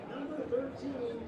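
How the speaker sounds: normal talking voice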